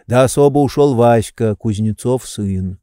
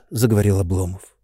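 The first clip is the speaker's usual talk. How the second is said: usual speaking voice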